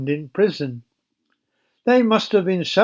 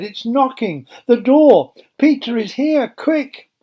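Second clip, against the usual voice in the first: high, louder, broken excited phrases; stammering; 7 semitones higher, 5 dB louder than usual. Acted. Rhoda telling the praying group, high and stammering. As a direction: blurt it out in bits. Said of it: "she stammered".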